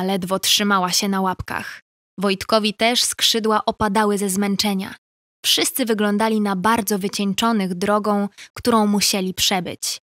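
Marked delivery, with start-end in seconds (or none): none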